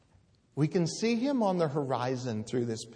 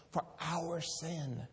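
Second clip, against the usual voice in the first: softer, slow, hushed aside; softly naming sin to the listeners; 4 semitones higher, 6 dB quieter, slower than usual. Acted. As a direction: let it drop to a near hush.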